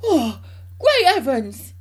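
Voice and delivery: high, loud, drawn-out exclamation; excited cry. A narrator's reading. George's father spotting the giant hen, crying out. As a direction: cry out slowly in loud amazement. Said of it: "he cried".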